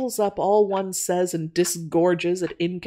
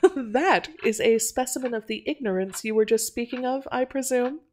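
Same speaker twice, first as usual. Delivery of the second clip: amused, laughing; 6 semitones higher than usual. A narrator's reading. Miss Archer to Natty once the customer left, laughing. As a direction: laugh first, then higher amused remark. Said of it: "laughed Miss Archer".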